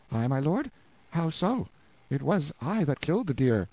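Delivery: a hint of a bewildered husband; lightly voiced prisoner. Prisoner confessing he killed the deer, lightly voiced. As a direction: admit it plainly. Hugo admits the deed.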